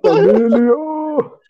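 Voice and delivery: high, loud, hammed-up catchphrase; exaggerated mock movie-star cry; Vin Diesel. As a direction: blow the catchphrase up into silliness. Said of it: "replaced with".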